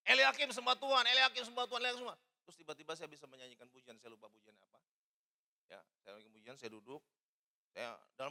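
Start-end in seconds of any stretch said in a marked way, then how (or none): 0.1-2.2 loud, high, urgent shouted order; forceful self-command. Retold or imitated. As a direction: shout at yourself to worship, pushing hard. Retold forcing himself to worship.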